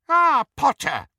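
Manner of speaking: put-on a clipped, high elderly witch's greeting; crisp old witch. A narrator's reading. Elderly witch greets Harry, crisp and high. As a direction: greet him as if you'd been waiting. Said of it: "she said crisply".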